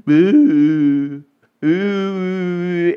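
hammed-up sulky boo; mock pouting. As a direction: pout it out like a sulking king. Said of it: "you just start pouting".

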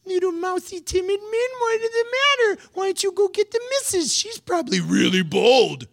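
high, teasing sneer at timid men; mock them in a squeaky voice; mocking Jesus. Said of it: "I think he's".

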